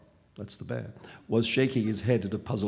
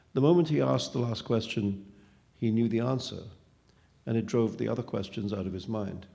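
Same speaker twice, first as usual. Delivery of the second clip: ordinary delivery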